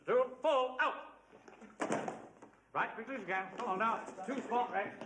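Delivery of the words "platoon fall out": drill command. Acted.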